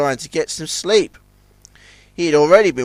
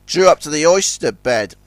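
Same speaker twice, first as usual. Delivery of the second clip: ordinary delivery, nothing marked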